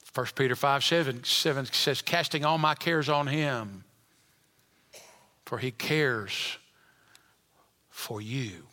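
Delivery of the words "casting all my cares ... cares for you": lower, softer, slowing to a hush; tender scripture reading. Real, not acted. Preacher reads First Peter to burdened congregation, tender. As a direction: read it gently, comforting.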